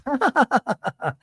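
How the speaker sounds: very high staccato laugh; hammed-up laugh in a squealed parody voice; high mock cackle. Host caps housewife parody, high mock cackle.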